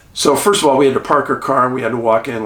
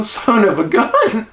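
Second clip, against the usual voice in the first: amazed "son of a gun"; 10 semitones higher, slower than usual. Retold or imitated. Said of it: "I go".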